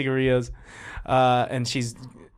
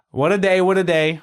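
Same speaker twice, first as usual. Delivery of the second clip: loud, raised Bronx line-read; Bronx accent; 4 semitones higher, 5 dB louder than usual. Shown as a demonstration. Director demonstrating the line for actress, Bronx accent. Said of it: "I'm from the Bronx".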